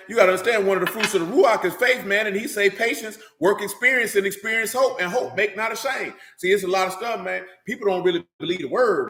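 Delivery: ordinary talk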